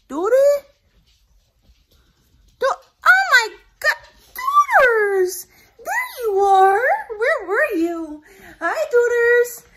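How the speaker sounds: high, sing-song pet voice. Real, not acted. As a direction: coo at the puppy. Greeting her dog in baby talk.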